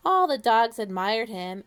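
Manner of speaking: ordinary delivery, nothing marked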